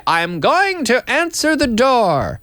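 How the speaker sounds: high, slow, stilted "I'm going to answer the door"; overly formal. Shown as a demonstration. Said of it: "I'm not gonna say".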